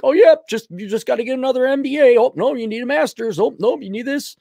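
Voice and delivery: high, quick teasing Lucy; mock goalpost-mover. Voices Lucy moving the goalposts.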